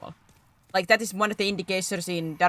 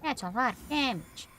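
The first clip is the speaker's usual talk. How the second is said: meme voice; 6 semitones higher than usual. Pretend. Quoting a meme line.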